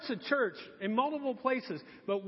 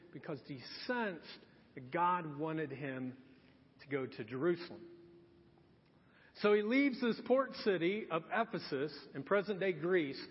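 ordinary talk, nothing marked

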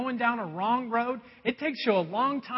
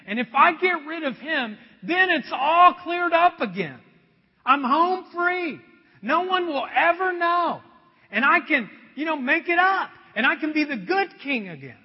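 gloating inner voice; 6 semitones higher, 7 dB louder than usual. Pretend. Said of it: "a voice in David's head".

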